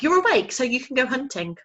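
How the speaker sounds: high, loud little owl insisting; insistent owlet. Acted. Owlet insisting his father spoke. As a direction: catch him out, small and eager.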